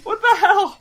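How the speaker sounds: very high, loud, laughing outburst; incredulous shout. Real, not acted. Baffled co-host reacting to the absurd trailer, incredulous shout. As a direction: shriek it in amused disbelief.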